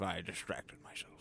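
ordinary talk, nothing marked

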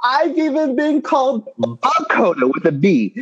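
high put-on voice for the misreading; mocking a clueless caller. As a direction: squeak out the wrong name in mockery. Mocking the wrong name.

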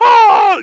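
very high, loud mock scream; falling puppet's wail; Kermit. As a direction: shriek like a frog puppet plunging down. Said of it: "Kermit falling off the building".

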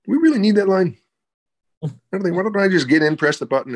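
pushy movie star. Retold or imitated. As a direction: imitate a star talking the director out of a line. Star tells director to cut line.

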